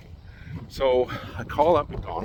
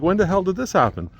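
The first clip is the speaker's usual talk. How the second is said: outraged; 9 semitones higher, 4 dB louder than usual. Retold or imitated. Outraged at phone news.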